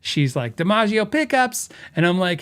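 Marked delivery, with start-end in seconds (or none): 0.5-1.7 higher-pitched New York phone greeting; hammy New York accent on the phone; bad New York accent. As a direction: imitate a New York receptionist answering. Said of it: "I have a horrible, uh, New York accent".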